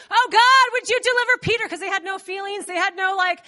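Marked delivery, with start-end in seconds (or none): 0.1-1.7 higher, loud opening cry to God; fervent prayer. Acted. Believers praying for Peter's deliverance, fervent. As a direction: pray out urgently, acted. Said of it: "And they're praying".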